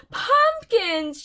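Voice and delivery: high, loud cry of disgust. Acted. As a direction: spit the word out in revulsion. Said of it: "she cried in disgust".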